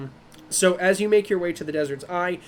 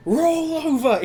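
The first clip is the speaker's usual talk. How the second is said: high, drawn-out call to dogs; playful raised-pitch command; 10 semitones higher, 4 dB louder, slower than usual. Acted. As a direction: call a trick out to the pack.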